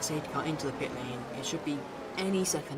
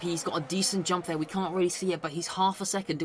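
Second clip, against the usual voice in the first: ordinary talk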